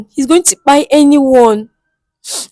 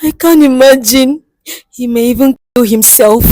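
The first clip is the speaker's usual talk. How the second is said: ordinary delivery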